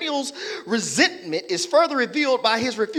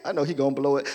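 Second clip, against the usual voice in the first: usual speaking voice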